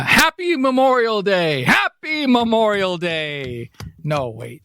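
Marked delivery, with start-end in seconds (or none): 0.0-1.6 cheery holiday greeting. Shown as a demonstration. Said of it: "do people say Happy Memorial Day". 1.6-3.7 slower sing-song Happy Memorial Day; drawn-out greeting. Shown as a demonstration. Host tries the holiday greeting a second time, drawn out. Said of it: "do people say Happy Memorial Day".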